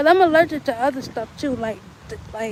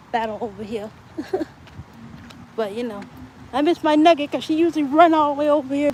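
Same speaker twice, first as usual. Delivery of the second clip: usual speaking voice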